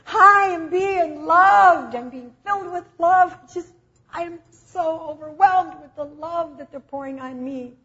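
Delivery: high, loud, drawn-out exultation; overwhelmed joy. Retold or imitated. Jesus in heaven tells of love poured on him, overwhelmed with joy. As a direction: act out being overcome with happiness, voice soaring. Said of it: "laughing and weeping".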